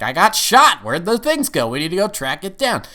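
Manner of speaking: high, urgent mock outburst; acted alarm. Shot agent waking in hospital, imitated urgency. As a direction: blurt it high, starting loud.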